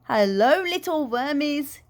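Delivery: loud, drawn-out gleeful greeting; cried out. A narrator's reading. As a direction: call out slowly to the worms, swooping up. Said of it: "he cried".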